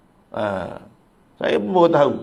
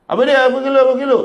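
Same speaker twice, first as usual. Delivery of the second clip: much higher and louder irritated retort; angry angler snapping back; 10 semitones higher, 5 dB louder, much faster than usual. Retold or imitated. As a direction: bite back at the nosy passer-by. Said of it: "Dia marah kita balik".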